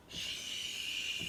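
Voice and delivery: soft breathy shh; whispering wind. Retold or imitated. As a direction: hush like leaves in the wind. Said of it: "it whispers".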